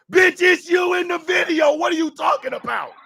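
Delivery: high, loud disbelieving shout; outraged shout at the guy in the video; incredulous yell. Real, not acted. Ranting at the apologizer.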